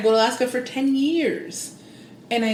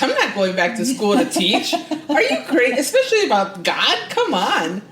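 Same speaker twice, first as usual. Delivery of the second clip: louder, laughing disbelief; laughing scoff; 3 semitones higher, 5 dB louder than usual. Retold or imitated. Scoffing at the spiritual teacher card, laughing. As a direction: laugh it off as absurd.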